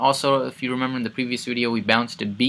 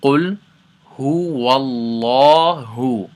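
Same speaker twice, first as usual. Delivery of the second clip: قُلْ هُوَ اللَّهُ chanted, louder, lips unrounded; Quran recitation; 2 semitones higher, 7 dB louder than usual. Shown as a demonstration. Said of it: "So all together".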